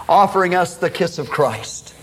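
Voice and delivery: usual speaking voice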